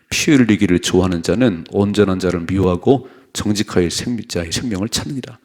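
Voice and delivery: loud, steady unison reading of the verse; scripture read aloud. Real, not acted. Pastor leads the congregation in reading, loud and steady. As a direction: read the proverb out together, loud and even.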